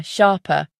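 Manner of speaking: ordinary delivery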